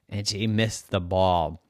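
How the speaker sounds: usual speaking voice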